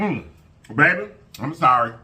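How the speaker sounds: ordinary talk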